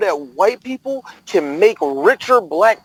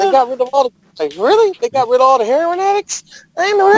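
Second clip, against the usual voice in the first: mock disbelief; 8 semitones higher than usual. Mock disbelief at friend's claim.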